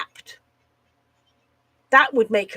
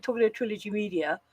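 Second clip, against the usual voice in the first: usual speaking voice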